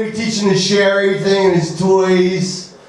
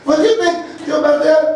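higher, lightly put-on Russian accent; 7 semitones higher, faster than usual. Girlfriend pressing him on the question, mock Russian accent. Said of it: "that's the accent".

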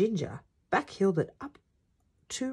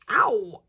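loud, higher acted yelp; cry of pain; 4 semitones higher, 7 dB louder than usual. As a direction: yelp as if just knocked. Player hurt during practice.

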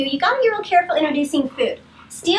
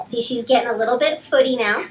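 ordinary delivery

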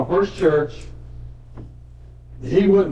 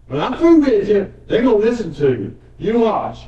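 voicing God; 5 semitones higher, 3 dB louder than usual. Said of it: "So, God's response".

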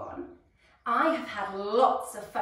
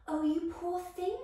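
sighing with pity; 7 semitones higher than usual. Acted. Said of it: "sighed Sugar Plum".

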